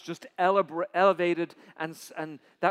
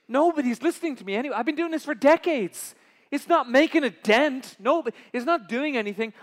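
loud, raised lament; despairing complaint; 6 semitones higher, 9 dB louder than usual. Acted. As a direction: pour out the despair, pitched high.

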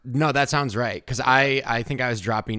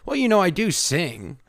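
coy talk show guest; 9 semitones higher, 6 dB louder than usual. Retold or imitated. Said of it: "a guest on, like, a late night talk show".

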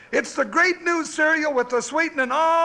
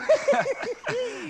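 much higher chuckle; hearty laugh; 10 semitones higher, 3 dB quieter than usual. Real, not acted. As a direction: laugh at his own pun. Laughs at own joke.

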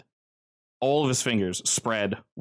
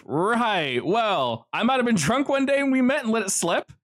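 Joe talking; 11 semitones higher, 3 dB louder than usual. Acted. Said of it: "And Joe goes".

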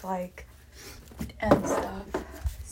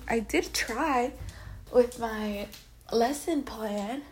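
ordinary talk, nothing marked

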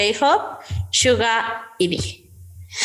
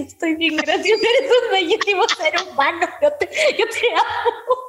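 dazed starstruck fan; 7 semitones higher than usual. Retold or imitated. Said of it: "Y así".

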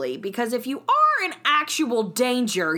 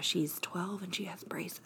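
whisper; 2 semitones lower, 12 dB quieter than usual. Pretend. Whispered meek persona.

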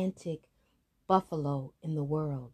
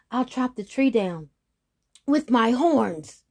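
roaring; 6 semitones higher, 7 dB louder than usual. Acted. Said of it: "roared the giant buffalo".

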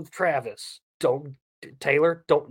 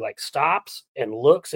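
usual speaking voice

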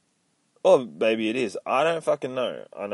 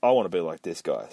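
ordinary talk

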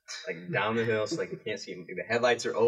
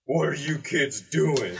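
slow, louder challenge of a grown man; gruff stranger; 4 semitones higher, 5 dB louder, slower than usual. Retold or imitated. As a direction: demand it slowly, catching the kids. Truck owner confronts the kids.

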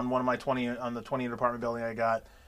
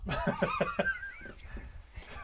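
squealing high-pitched laugh; 7 dB quieter than usual. Real, not acted. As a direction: let out a shrill cackle. Laughing at his own story.

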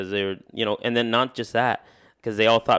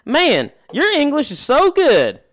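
slow, high, loud mock praise; gushing stranger; 7 dB louder, much slower than usual. Man imitating guy at work praising his English, gushing. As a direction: imitate a stranger's drawn-out, over-loud compliment. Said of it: "he walks up and he's like".

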